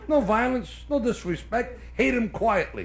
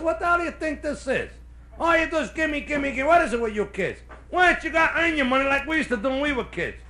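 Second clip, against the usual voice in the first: lightly voiced, high exasperated mom; a hint of nagging mother; scolding mother; 6 semitones higher than usual. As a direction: scold a kid who keeps asking. Mother scolds kid for asking money.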